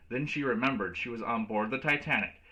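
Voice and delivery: ordinary delivery, nothing marked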